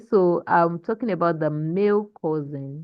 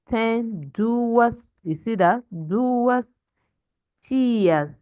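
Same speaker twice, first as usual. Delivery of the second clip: slow, word-by-word Portuguese with stretched stressed syllables; model reading; 3 semitones higher, slower than usual. Shown as a demonstration. Teacher showing class how to read correctly, slow model reading. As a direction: read it out deliberately, lifting phrase ends. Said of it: "That's how to read correctly in Portuguese".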